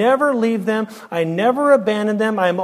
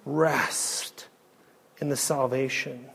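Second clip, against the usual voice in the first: much softer, almost whispered comfort; hushed assurance; 6 semitones lower, 12 dB quieter, slower than usual. Acted. Pastor closing the sermon with hushed reassurance to the flock. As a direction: breathe the words of rest to them. Tender close, the Lord's assurance.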